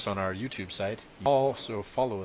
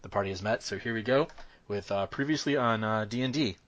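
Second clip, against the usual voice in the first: normal talking voice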